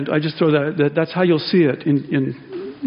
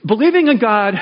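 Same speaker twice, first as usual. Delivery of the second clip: usual speaking voice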